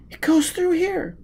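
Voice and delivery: high female voice, urgent; young woman's voice. A narrator's reading. Gisbella telling Foyle where the river goes, in a high voice. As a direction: pitch up for the girl.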